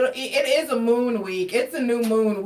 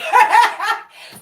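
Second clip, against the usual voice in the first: high burst of laughter; 11 semitones higher, 7 dB louder than usual. Real, not acted. Laughing at a cusser.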